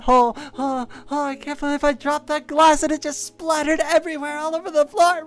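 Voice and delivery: building from flustered to high panicked wail; mock panic.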